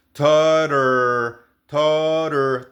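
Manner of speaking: "totter" with the middle tt as a Spanish r; flapped T. Shown as a demonstration. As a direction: say "totter" as "tor-er". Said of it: "de la T es r". ("totter" con la doble T como una R nuestra)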